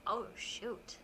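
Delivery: normal talking voice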